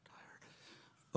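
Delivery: breathy whispered Tired? in disbelief. Retold or imitated. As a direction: drop to a whisper, asking in disbelief. Incredulous whisper in retold restaurant scene.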